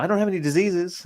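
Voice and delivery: put-on a high, indignant New Zealand accent. Mimicking the date from New Zealand snapping back in a high New Zealand accent. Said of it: "I can't even imitate their accent".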